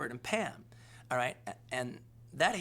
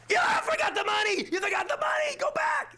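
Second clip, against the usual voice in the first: very high, loud yell at the movie; shouting at the screen; 7 dB louder than usual. Retold or imitated. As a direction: holler at the screen like a rowdy crowd. Audience yelling at screen.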